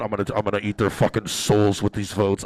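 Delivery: lightly voiced greedy politician, dropped low; mock villainous aside.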